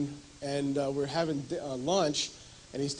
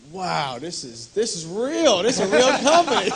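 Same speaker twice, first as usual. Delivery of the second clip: laughing amazement; 5 semitones higher, 7 dB louder than usual. Retold or imitated.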